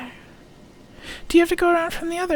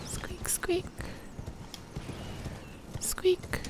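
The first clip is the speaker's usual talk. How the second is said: soft high squeaking; playful whispered rat noise; mock rat squeaks; 3 semitones higher, 10 dB quieter, slower than usual. Imitating rats.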